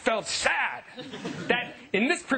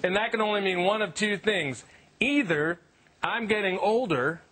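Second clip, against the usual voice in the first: ordinary talk, nothing marked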